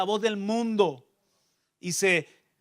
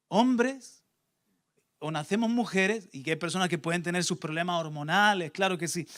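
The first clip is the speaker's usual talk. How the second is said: ordinary delivery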